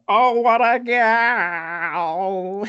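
very slow, high drawn-out gush; mock swoon. Imitating Toby gushing over the girl, slow and high. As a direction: stretch it out like a lovestruck fool. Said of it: "Toby's all like".

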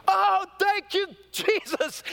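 high, loud imitated cry of joy; ecstatic thanks. Imitating mother finding her rings, high joyful cry. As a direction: burst out thanking Jesus in relief. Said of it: "She goes".

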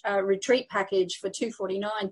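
ordinary talk

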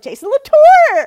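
high, loud, drawn-out call of the name; excited shout-out of a name. Retold or imitated. Friend recalling Preeti spotting Jason Latour, excited high call. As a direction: imitate a friend spotting a celebrity, calling out his name. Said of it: "I hear in my head Preeti being like".

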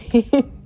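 brief, high giggle; short laugh. Real, not acted. Speaker laughing at friend's reply, short high laugh.

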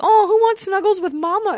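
high cooing mama voice; baby talk to pet. Retold or imitated. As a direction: coo to the cat. Commercial girl calling her cat.

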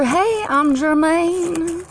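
high, drawn-out put-on German accent; mock German accent. Shown as a demonstration. Showing how tongues shape accents, mock German accent. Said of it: "gives that special hint that".

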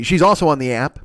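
usual speaking voice

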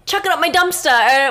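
loud misquote in a surfer's voice; Surfer Boy. Retold or imitated. Host tries recalling a surfer's saying in a mock surfer voice. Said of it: "Surfer Boy said".

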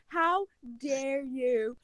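drawn-out, playfully scandalized reproach; mock outrage. Co-host defending people who cry, mock outraged. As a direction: stretch it very slowly in mock offence.

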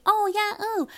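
very high, small mock coo; imitated gushing. Imitating Becca's reaction.

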